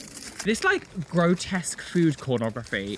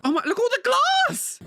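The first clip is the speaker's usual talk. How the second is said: much higher and louder, fast; shocked shout; 4 dB louder, faster than usual. Real, not acted. Seeing glass smashed everywhere, shouting in shock. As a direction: yell at the mess of glass.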